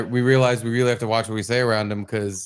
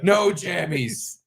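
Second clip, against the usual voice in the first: put-on slow, higher-pitched childish refusal; protesting toddler; 4 semitones higher, much slower than usual. Dad imitating his son who wanted to stay up, drawn-out protest. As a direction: drag it out like a kid refusing bedtime. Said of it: "'cause he wanted to stay up".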